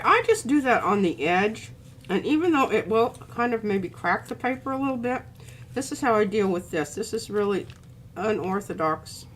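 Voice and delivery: ordinary talk, nothing marked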